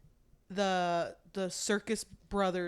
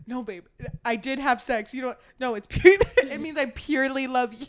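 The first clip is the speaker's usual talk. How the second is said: mock earnest boyfriend; 4 semitones higher, 8 dB louder than usual. Voicing Gus to his girlfriend.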